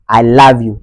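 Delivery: LOVE as "lahv" in a Ghanaian accent. Shown as a demonstration. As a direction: repeat love with a Ghanaian open "ah". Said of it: "du côté ghanéen".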